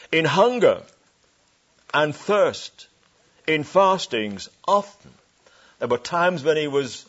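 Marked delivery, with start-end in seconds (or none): 0.1-5.1 louder, ringing reading of the verse; preached scripture. Acted. Preacher proclaiming Paul's hunger to the congregation. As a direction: proclaim it to the back row.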